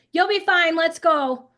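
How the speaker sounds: mock impatient parent; dismissive parent. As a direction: hurry the kid along. Rushed parent brushing off.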